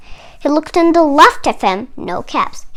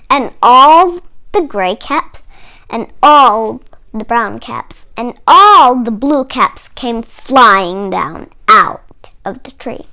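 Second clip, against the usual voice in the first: usual speaking voice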